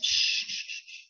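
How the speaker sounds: whispered shh to hush the room; shushing. A narrator's reading. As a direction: softly hush the children. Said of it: "put her fingers to her lips".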